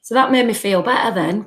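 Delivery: usual speaking voice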